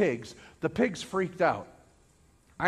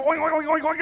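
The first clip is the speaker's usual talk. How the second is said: rapid high-pitched oinking; frantic pig oinks; 7 semitones higher, faster than usual. Retold or imitated. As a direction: imitate a panicking herd of pigs. Imitates panicking pigs.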